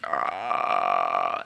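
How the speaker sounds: imitating a slowed-down toy voice; very low droning "ah"; low drawn-out toy sound. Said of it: "it's suddenly like".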